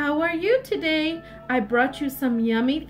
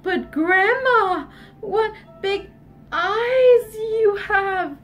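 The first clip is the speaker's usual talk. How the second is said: slow, drawn-out wonder, pitched up; marvel, stretching out the key words; higher storybook astonishment; wide-eyed amazement; 5 semitones higher, much slower than usual. Acted. Red marvels at Grandma's eyes.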